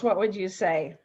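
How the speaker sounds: ordinary delivery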